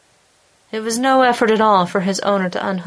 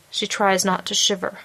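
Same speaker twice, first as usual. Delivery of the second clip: ordinary delivery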